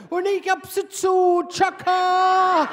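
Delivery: shouting back from the changing room, triumphant; triumphant yell. Retold or imitated. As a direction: bellow it out in triumph. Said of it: "rausgebrüllt".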